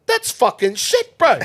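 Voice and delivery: high, loud Kiwi put-down; old Kiwi hand dismissing the kid's work, loud high Kiwi voice. Retold or imitated. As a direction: bark it like a blunt old Kiwi. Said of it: "old Kiwi fella".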